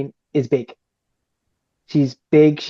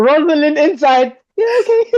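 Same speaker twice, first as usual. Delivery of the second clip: giggling, high and loud; laughing squeal; 12 semitones higher, 4 dB louder than usual. Real, not acted. Laughing imitation of inner self.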